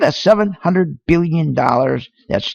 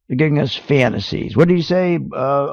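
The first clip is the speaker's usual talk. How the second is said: ordinary delivery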